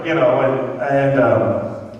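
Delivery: ordinary talk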